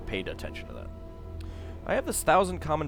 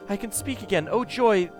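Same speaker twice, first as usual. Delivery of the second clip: joyful; 9 semitones higher, 5 dB louder than usual. Acted. Echo speaks again, joyful.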